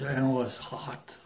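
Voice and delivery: ordinary delivery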